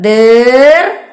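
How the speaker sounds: ദീർ held long and loud, then ഘം; loud, drawn-out sounding out of ദീർ-ഘം; reading demonstration. Shown as a demonstration. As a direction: break ദീർ-ഘം into two stretched parts. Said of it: "എന്താ വായിക്ക?".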